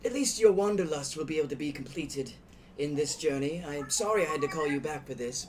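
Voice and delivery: gracious elder greeting; NPC voice. Acted.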